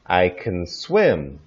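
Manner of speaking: I can swim slowly with can reduced to a weak kən. Shown as a demonstration. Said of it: "Can, can".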